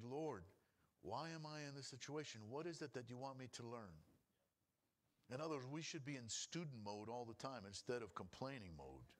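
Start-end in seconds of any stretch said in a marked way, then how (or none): 0.0-4.0 softer, lower humble asking; humble prayer. Retold or imitated. A believer asking God what to learn, quiet and humble. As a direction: ask God quietly like a student.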